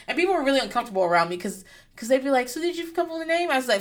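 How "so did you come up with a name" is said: mock chirpy asker. People asking her.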